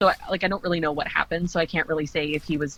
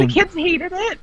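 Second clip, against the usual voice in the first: high-pitched disbelieving echo; incredulous question; 13 semitones higher, 4 dB louder than usual. Real, not acted. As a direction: ask it in surprise, voice jumping up. Incredulous echo of friend's report.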